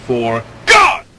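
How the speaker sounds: "For God" hit loud and short; short shout. Shown as a demonstration. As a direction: blast the words out in one burst. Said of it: "You can do better".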